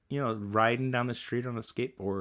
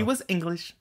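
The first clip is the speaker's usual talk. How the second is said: higher, fading English put-on voice; lightly held Pippin accent; 9 semitones higher than usual. Shown as a demonstration. Still half in the cartoon English accent while explaining the joke. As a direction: let the English voice trail off. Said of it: "It's me trying to do the Pippin accent".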